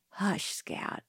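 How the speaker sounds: soft, near-whispered hush; soothing under-the-breath shushing; gentle hushing. A narrator's reading. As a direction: hush a frightened child very quietly. Boy hushing his frightened sister.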